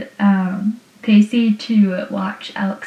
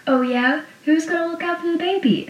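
a hint of a smug dad, higher and quick; typical dad line; 8 semitones higher, 3 dB quieter than usual. Pacey jabbing back at Joey with a lightly voiced dad retort. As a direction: toss off a light mock-dad comeback, fast. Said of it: "the typical, like, dad line".

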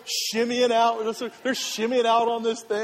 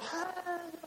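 high, soft, drawn-out call; mimicked distant greeting; 10 semitones higher, 9 dB quieter than usual. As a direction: imitate a little far-off "hey". Group calling across canyon to wife.

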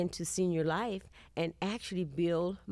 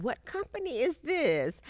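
surprised question; 9 semitones higher, 3 dB louder than usual. Retold or imitated. Retold eager question at seminar.

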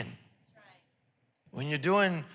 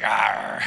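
low mock tiger growl; husband as a tiger, a hammed-up low growl; 10 semitones lower than usual. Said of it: "He's like".

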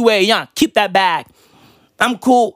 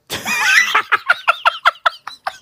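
high staccato wheezing laugh; long high cackle; real laughter. Real, not acted. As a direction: burst out laughing at your own bit. Cracks up at own bit.

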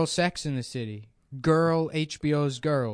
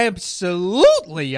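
"Absolutely" drawn out and loud; emphatic agreement; 8 dB louder, slower than usual. Acted. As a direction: stretch "Absolutely" slowly with big, hammed-up force. Comic emphatic answer about loving films.

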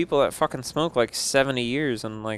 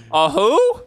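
mock surprise; 13 semitones higher, 5 dB louder than usual. Mock confusion joke.

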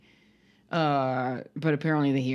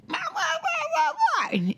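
mocking toddler yell. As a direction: mimic a small child's wailing yell while laughing. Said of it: "now she's like".

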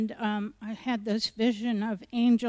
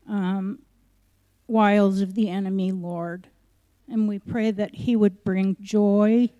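earnest prayer voice, often louder; group prayer; 7 dB louder than usual. Acted. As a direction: lead the church in prayer.

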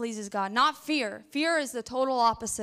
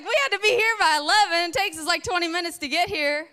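high, louder flustered rush; panicked hurry; 6 semitones higher, 5 dB louder than usual. Retold or imitated. Wife panics about being late, high and flustered. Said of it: "I was like".

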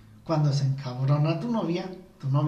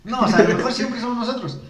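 laughing; 7 semitones higher, 5 dB louder than usual. Real, not acted. Laughing admission.

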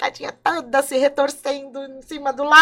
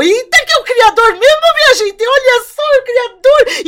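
high, loud, thrilled exclamation; excited awe; 12 semitones higher, 7 dB louder than usual. Acted. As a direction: gasp out the wonder of meeting the Creator. Ele encontra o Criador. (espanto eufórico, bem agudo e alto)

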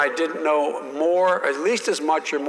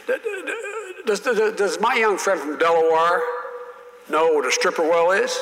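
Russell Long stammering; 4 semitones higher than usual. Retold or imitated. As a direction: stutter out a mocking challenge, pitched up. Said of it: "He kinda talked like this when he talked".

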